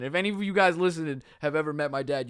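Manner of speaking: ordinary delivery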